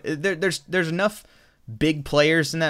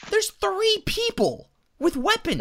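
much higher, drawn-out protest; incredulous objection. Real, not acted. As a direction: stretch it out high in disbelief. Objecting to the film's logic.

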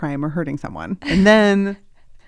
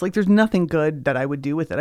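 ordinary talk, nothing marked

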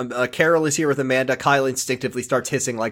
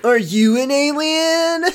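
very high, slow squeaky question; put-on silly voice; slower than usual. Co-host jokingly asking if he's an alien, high put-on voice. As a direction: stretch it out in a high mock voice.

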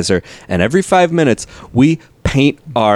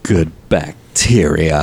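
even lower, slower echo of "Good bacteria"; mock solemn; 6 semitones lower, slower than usual. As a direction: repeat "Good bacteria" low and drawn out, hushed. Echoing mockingly.

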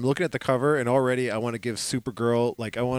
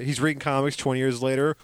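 ordinary talk

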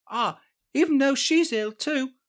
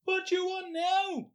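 put-on old wife voice; 5 semitones higher, 6 dB quieter than usual. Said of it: "Why does your accent keep changing?".

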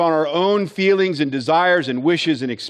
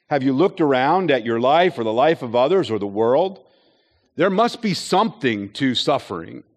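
ordinary talk

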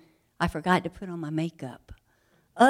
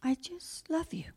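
tender whisper; 8 semitones higher, 6 dB quieter than usual. Acted. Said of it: "he says".

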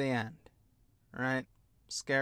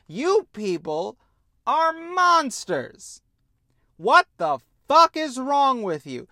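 loud, higher, the word monsters stretched slowly; outraged yell; 7 semitones higher, 7 dB louder than usual. Retold or imitated. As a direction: shout it at the screen, appalled. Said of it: "And I go".

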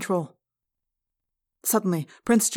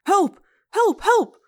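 high, loud, urgent cries; shouting for help; 13 semitones higher, 6 dB louder, much slower than usual. A narrator's reading. Pupil running into the hallway, shouting for help. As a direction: call out in alarm, very high. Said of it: "began to shout".

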